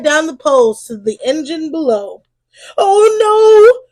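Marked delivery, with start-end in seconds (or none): 2.8-3.8 high, loud dismayed cry; shouting. A narrator's reading. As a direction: yelp in alarm. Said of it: "shout Drippy and Sticky".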